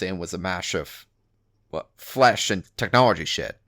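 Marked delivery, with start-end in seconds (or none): none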